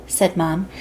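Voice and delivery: ordinary delivery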